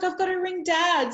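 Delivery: high, bright rush of eagerness; can't wait to tell him; eager girl. Retold or imitated. Daughter rushing to phone her dad, eager and high.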